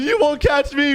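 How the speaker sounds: high-pitched getaway taunt; fleeing taunt. Acted. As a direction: call it out while making a run for it. Suspect flees the cop.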